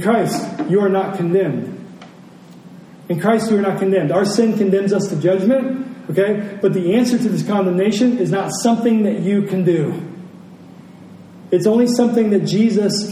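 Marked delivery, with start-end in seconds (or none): none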